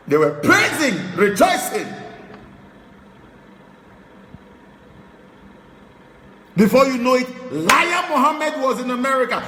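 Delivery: high, loud, fervent sermon voice; fiery preaching. Real, not acted. Preacher mocking critics to followers in a loud, high excited rant.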